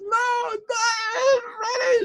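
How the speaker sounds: loud, very high mock bawling; crying wail. Taxi driver refuses another dog, wailing for Freddie. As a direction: howl it out in hammed-up grief. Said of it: "He started crying".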